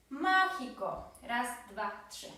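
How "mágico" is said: mágico with MÁ drawn out, high and loud; slow demo of mágico, stressed on má; stress on first syllable. Shown as a demonstration. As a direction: hit the má of mágico, then let it fall. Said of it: "Akcent pada na ma".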